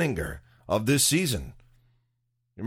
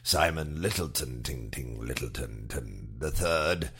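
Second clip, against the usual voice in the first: low, softer broadcast link; spoof radio presenter; 8 semitones lower, 4 dB quieter than usual. Pretend. Presenter introducing the arts correspondent, low and deadpan.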